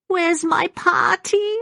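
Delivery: put-on slow, high-pitched, puzzled old woman; rattling old voice. A narrator's reading. Puzzled Maggie looks for her lost doll, rattling voice. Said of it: "she asked in her rattling voice".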